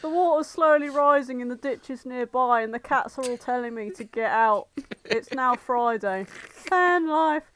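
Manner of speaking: loud mock horror voiceover; mock ominous narration. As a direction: spin the creepy day like a spoof diary, hammed up. Mock spooky daily-life narration.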